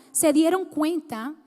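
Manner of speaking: ordinary delivery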